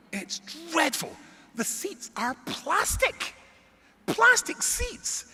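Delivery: high, loud, drawn-out complaint; hammed-up slow indignation, voice soaring; comic outrage. Real, not acted. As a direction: stretch each word in appalled disbelief. Comic rant about cheap airline.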